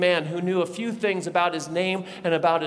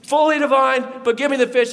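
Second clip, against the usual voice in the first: raised, punchy declaration; emphatic insistence; 7 semitones higher, 5 dB louder than usual. Acted. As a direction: prove the point, loud and high. Jesus insists he is physical.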